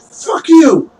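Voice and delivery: high, loud spat-out curse; furious retort. Acted. Cornered thief curses the townsperson, high and loud. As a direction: spit it back at the crowd.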